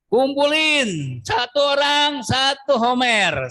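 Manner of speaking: slow, high, emphatic command. Acted. God instructing Israel to gather manna, slow and emphatic. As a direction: lay down the rule slowly. Preacher quoting God's command.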